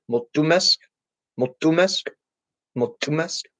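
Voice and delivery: mulțumesc stressed on ȚU, rising each time; mul-ȚU-mesc, questioning lift; stress on middle syllable. Shown as a demonstration. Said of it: "syllable is the accent on".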